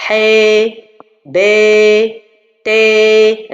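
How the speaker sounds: "He", "be", "te" with the vowel bent toward i; wrong leaning to kasra. Shown as a demonstration. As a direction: tilt "He" "be" "te" toward an i sound. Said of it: "Ça aussi, c'est faux".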